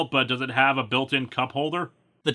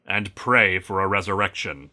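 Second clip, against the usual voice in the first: lower, solemn eulogy voice; Superman giving a eulogy; 7 semitones lower than usual. Acted. Superman eulogizing a fallen teammate on Mars, low and solemn. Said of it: "with Superman giving his eulogy".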